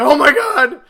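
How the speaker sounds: loud, high, laughing exclamation; retold fan excitement, loud and laughing; excited oh my god. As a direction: blurt it out with a laugh, thrilled. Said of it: "I'm like".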